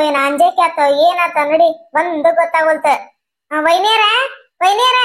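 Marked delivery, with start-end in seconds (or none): 4.6-5.0 higher, rising call; pointed summons with a raised pitch; insistent calling. Acted. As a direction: call out to get her attention. Repeated, more insistent call.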